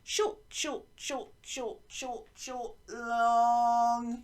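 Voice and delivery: quick "short" taps then a long held "long"; rhythm demo. Shown as a demonstration. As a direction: count out the rhythm, stretch "long". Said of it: "you might want to go".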